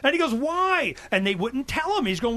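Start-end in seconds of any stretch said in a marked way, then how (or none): 0.3-0.9 baffled quote. Retold or imitated. Acts out columnist demanding an explanation.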